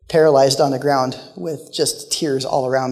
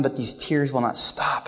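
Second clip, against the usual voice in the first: ordinary delivery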